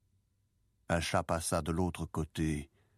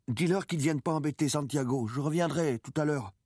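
put-on higher-pitched, firm young boy; insistent boy; 9 semitones higher, 3 dB louder than usual. A narrator's reading. Boy protecting the sleeping old man, insistent. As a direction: give the order in a raised boyish pitch.

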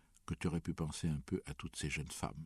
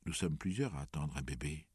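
plain reading voice

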